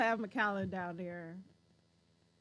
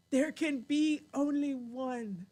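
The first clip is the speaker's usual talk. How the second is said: slow, drawn-out dramatic proclamation; Highlander movie quote; 2 semitones higher, 3 dB louder, slower than usual. Retold or imitated. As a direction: declare it like an epic film hero. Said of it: "did you watch The Highlander?".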